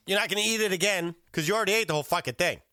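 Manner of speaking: fast, high indignant burst; indignant rant. Real, not acted. Host ranting about the greedy diner, indignant.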